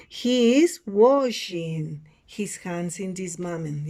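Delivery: model sentence for repeating. Shown as a demonstration. Teacher repeats picture four for students, slow model delivery. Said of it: "Repeat after me".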